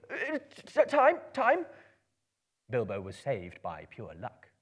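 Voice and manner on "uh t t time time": high stammered squeak; frightened stuttering squeal; sudden squeal. Acted. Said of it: "a sudden squeal".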